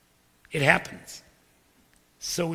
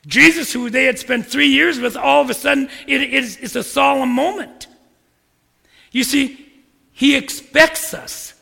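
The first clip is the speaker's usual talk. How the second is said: louder, higher, full-force preaching; 5 semitones higher, 9 dB louder than usual. Acted. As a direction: drive it home to the congregation. Preacher builds to climax.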